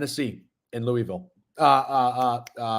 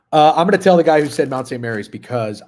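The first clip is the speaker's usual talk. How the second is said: ordinary talk, nothing marked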